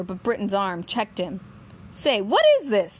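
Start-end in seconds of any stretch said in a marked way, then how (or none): 1.9-3.0 higher, louder challenge; demanding. A narrator's reading. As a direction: demand an answer. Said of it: "Jan demanded".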